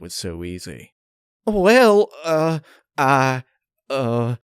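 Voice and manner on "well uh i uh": high, loud, halting nervous stammer. A narrator's reading. Applejack caught lying, stammers nervously. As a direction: caught out, stalling for words. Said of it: "Applejack was sweating".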